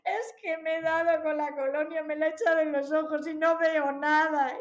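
high, loud mock wailing; imitated crying daughter. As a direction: wail the complaint like a distressed child. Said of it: "mi hija Julieta que viene gritando y llorando". (imitando el lloriqueo exagerado de una hija)

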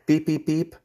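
three high vocal beeps; whistle beeps. Shown as a demonstration. Trainer showing recall with body language, high beeps. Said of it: "same body language".

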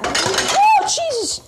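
high, startled oh Jesus; shocked gasp. Retold or imitated. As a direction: recoil in shock at the sight. Neighbours' shocked reaction imitated.

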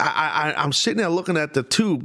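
usual speaking voice

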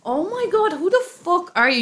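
high-pitched, scandalised disbelief; mock outrage. Real, not acted. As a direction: exclaim high, as if appalled by a friend. Mock outrage at friend's confession.

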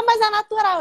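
high, defensive imitation of the mother; imitating the mother. Mãe se defende. (imitando a mãe se defendendo, voz aguda)

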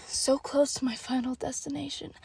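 hushed suspense whisper. Retold or imitated. Shopper whispering as she nears the goal. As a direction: whisper it like a tense finish line. Nearly at the checkout.

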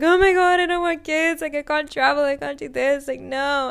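imitating her own freak-out, high and loud; panicked outburst. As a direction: blurt it out like the walls are closing in.

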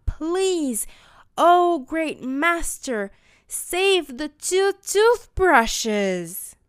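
slow, loud group plea; chorus in unison. Shown as a demonstration. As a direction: chant it slowly together with the kids. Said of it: "Say it with me".

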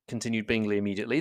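ordinary delivery

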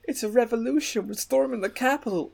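very high mock woman's voice; high-pitched hammed-up protester; imitating indignant protester. Imitating woman in viral video.

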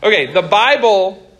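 usual speaking voice

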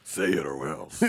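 very low, menacing mock voice; mock threat. Friends riffing on forcing words out of someone, mock threat. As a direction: drop it deep and soft for a playful threat.

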